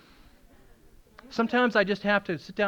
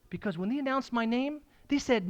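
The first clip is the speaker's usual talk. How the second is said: ordinary delivery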